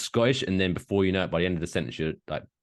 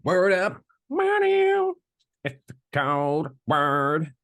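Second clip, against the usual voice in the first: high, drawn-out mock catchphrase voice; hammed-up riff; 7 semitones higher, much slower than usual. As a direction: stretch it out high and silly.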